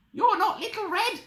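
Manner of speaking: put-on very high, shocked old-lady voice; alarmed Granny. Granny discovering the wolf, high alarmed cry. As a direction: squeal the accusation in alarm. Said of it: "yelled Granny".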